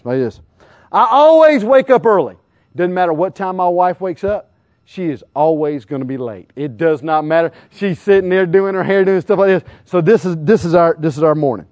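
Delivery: ordinary talk, nothing marked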